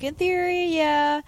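high-pitched, noncommittal mock reply; hedging. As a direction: float it up high, as if dodging. Acted evasive answer to a demand.

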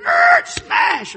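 piercingly high, very loud screech and smash; explosive sound-effect crash; imitated car crash. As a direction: mimic squealing tires at full volume.

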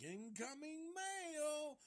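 very high, slow, gleeful crazy sing-song; Chop Top voice. Retold or imitated. Chop Top greets his victim with a high slow crazy cry. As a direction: announce the hammer blow like a deranged mailman. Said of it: "and he's like".

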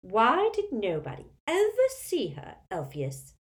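put-on high, squawky old aunt; squawking. A narrator's reading. Aunt challenging Elphias about the hidden girl, squawking. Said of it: "squawked Muriel".

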